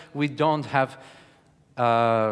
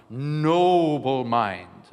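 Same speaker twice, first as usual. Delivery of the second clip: "Noble" stretched very slowly and round; full-mouthed noble; 3 dB louder, much slower than usual. Shown as a demonstration. Coach demonstrating, a slow full-mouthed "Noble mind". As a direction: savour "Noble mind" like a rich bite. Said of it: "the noble to be full-mouthed".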